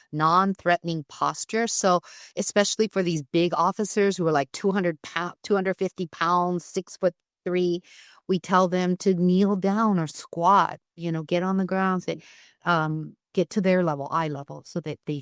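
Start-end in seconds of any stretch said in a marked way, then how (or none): none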